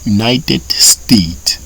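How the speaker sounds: very slow, syllable by syllable; drawn-out teacher pronunciation; slow model reading. Shown as a demonstration. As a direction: spell it out slowly for learners. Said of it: "pour dire États-Unis".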